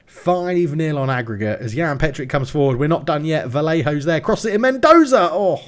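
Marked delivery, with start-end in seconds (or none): 4.2-5.6 excited. Real, not acted. Near miss in commentary.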